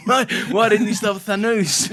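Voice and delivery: high, loud mock line through laughter; laughing while hamming a stiff movie line; mocking Kit Harington. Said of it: "Every time he says it, it's slightly different".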